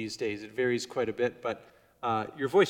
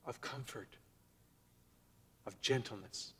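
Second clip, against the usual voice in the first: much softer, hushed and tender; gentle, comforting; 4 semitones lower, 17 dB quieter than usual. Shown as a demonstration. Preacher contrasting the powerful prophets with comfort, hushed gentle voice. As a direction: drop right down to a soft voice of comfort. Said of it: "now we have the voice".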